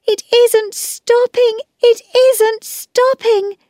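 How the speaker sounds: put-on very high girlish cry; alarmed little girl calling out; panicked shout. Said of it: "Phyllis shouted".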